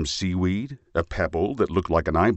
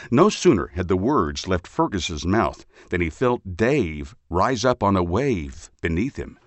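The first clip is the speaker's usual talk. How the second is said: usual speaking voice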